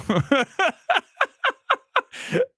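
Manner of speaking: high burst of laughter. Real, not acted. Host laughing off his own rant, high laugh. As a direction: laugh it off, relieved.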